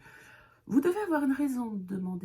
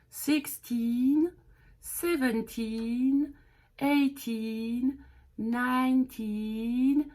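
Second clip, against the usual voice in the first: slow counting; 4 semitones higher, much slower than usual. Shown as a demonstration. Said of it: "counting slowly for learners".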